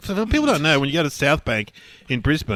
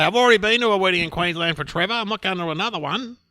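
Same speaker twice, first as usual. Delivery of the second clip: higher, louder voice of a protesting father; raised-voice imitation of an indignant dad; exasperated dad; 8 semitones higher, 4 dB louder than usual. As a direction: act out Dad digging his heels in. Acting out dad refusing wedding.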